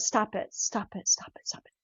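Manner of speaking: self-correcting from stern to whispered; fading to whisper. Retold or imitated. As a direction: stop it repeated, fading into a whisper. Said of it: "And I went".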